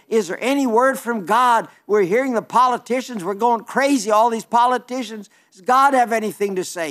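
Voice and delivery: desperate crowd asking for God's word; anxious plea. Retold or imitated. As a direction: voice the crowd begging for a word from God. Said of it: "they're saying".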